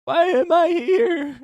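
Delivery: high, slow, lost character voice; dazed boy voice. Retold or imitated. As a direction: plead it like a confused boy. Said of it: "he's saying".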